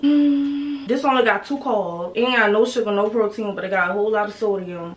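ordinary delivery

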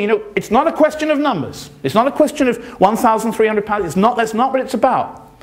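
hammed-up quote of a spokesman; mimicking the official. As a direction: lightly parody his smooth dismissal. Quoting the official's dismissive argument.

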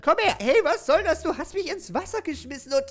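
high, loud indignant yell; loud protest. Acted. [name] being thrown into the pool, loud protest. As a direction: shout as you're grabbed.

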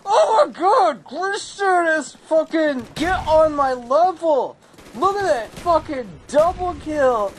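high, hyped gamer yelling; pumped-up gloating; excited yelling. Real, not acted. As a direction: brag at the top of the voice. Gamer hyped over his kills.

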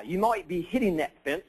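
attempted Aussie accent. Colleague warning him about the fence, badly imitated Aussie-Kiwi accent. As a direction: imitate an Australian, landing nearer New Zealand. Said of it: "might be more Kiwi than Aussie".

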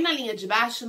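ordinary talk, nothing marked

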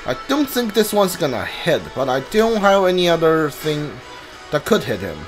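usual speaking voice

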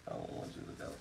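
hushed, pretend-tearful whisper; mock sad whisper. Owner pretending to plead with the dog he is selling, whispered. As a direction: whisper it like a half-joking goodbye. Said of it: "I'm gonna cry".

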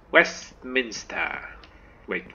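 ordinary delivery

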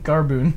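Carboon with a held long oo; mock Carboon; Carboon mispronounced. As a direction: draw out Carboon. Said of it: "he says Carboon".

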